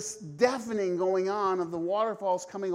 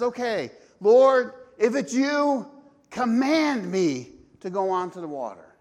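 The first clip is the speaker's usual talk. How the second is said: loud, eager, higher plea; bold Peter; 3 semitones higher, 7 dB louder than usual. Retold or imitated. Peter asking Jesus to walk on the water, eager and loud. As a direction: call out boldly across the water.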